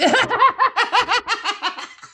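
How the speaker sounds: loud high cackle; giggling at the skinhead joke; high cackling laugh. Real, not acted. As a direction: cackle at your own joke.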